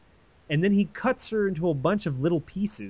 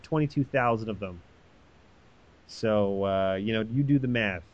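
usual speaking voice

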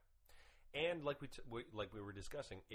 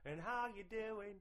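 higher, slow hammed-up Aussie drawl; Australian accent; 7 semitones higher, 4 dB louder, slower than usual. Host showing off his Australian accent to beat his co-host. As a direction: ham up the Australian slang. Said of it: "Mine's way better".

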